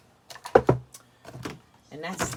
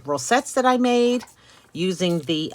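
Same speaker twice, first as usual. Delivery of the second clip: usual speaking voice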